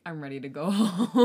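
laughing through the words. Retold or imitated. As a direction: laugh mid-line. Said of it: "I was like".

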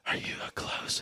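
hushed whispered question; asking the girlfriend in a whisper. Retold or imitated. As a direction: whisper it close. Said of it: "I'll be like".

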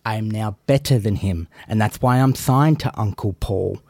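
ordinary talk

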